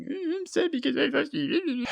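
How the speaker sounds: high, soft mocking singer voice; mock the song as limp and whiny. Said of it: "It's just kind of like".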